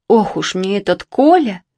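plain reading voice

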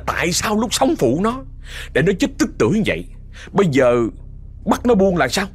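high-pitched, obstinate retort; stubborn defiance. Retold or imitated. Possessing spirit argues with the exorcist, stubbornly defiant. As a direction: imitate a spirit digging in its heels. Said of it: "cái giọng kia thì nó vẫn lì".

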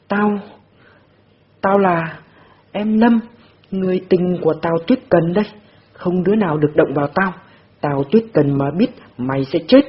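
high, loud crazed woman; madwoman shouting. A narrator's reading. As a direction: rave like a madwoman. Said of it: "Mẹ Đồng Tử Tịch lại gào lên". (bà điên gào thét)

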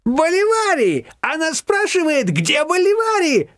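high, slow, laughing cry; laughing shout. A narrator's reading. Shushana shouting to Irma through laughter. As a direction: howl it down the phone. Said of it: "кричала Шушана в трубку".

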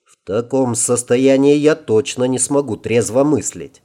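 plain reading voice

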